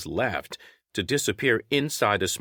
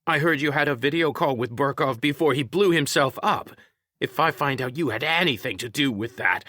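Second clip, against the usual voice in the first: higher, mildly angry accusation; restrained indignant confrontation; heated; 7 semitones higher than usual. A narrator's reading. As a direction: accuse with rising heat. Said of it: "Lou asked him heatedly".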